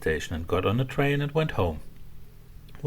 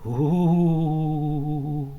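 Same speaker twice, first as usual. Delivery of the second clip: high, hollow ghost moan; a long eerie ooh floating out of the dark; ghostly ooh; 7 semitones higher, 4 dB louder than usual. A narrator's reading. Ghost moaning in the castle, high eerie ooh. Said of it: "a sound that went".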